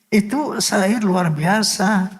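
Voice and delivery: slow, high-pitched praise in wonder; moved admiration. Real, not acted. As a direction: marvel at it, drawing the words out. Moved by his own song.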